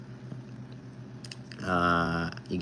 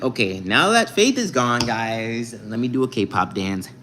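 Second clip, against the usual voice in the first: ordinary talk, nothing marked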